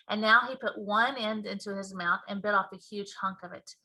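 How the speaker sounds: ordinary delivery, nothing marked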